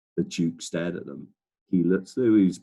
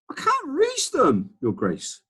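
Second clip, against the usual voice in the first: much higher, slow calling voice; gardener calling back; 3 dB louder, slower than usual. Acted. Gardener calling to the Duke from the ladder, much higher. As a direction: call up apologetically from the ladder. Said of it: "the gardener called back".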